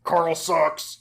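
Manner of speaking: loud played-back drop clip; punchy recorded sound drop; soundboard drop. Shown as a demonstration. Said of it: "you can, uh, demonstrate?".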